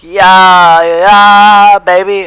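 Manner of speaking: loud, high hyped-up yells; mock cheering lads. The boys celebrating him at school, loud hyped cheer. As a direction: hype him up like cheering mates. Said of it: "all the guys like".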